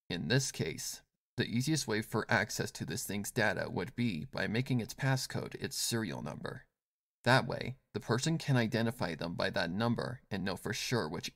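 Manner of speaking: low, soft, sly woman voice; unbothered and knowing; cool confident woman. Acted. Yelan's voice in dialogue.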